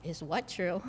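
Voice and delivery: soft, low aside to herself; quietly bewildered inner question; inner thought, hushed. Retold or imitated. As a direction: drop the voice as if thinking it. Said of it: "I'm thinking to myself".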